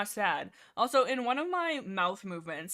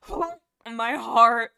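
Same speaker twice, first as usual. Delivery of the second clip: mock heartbreak; 10 dB louder, much slower than usual. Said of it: "I'm like".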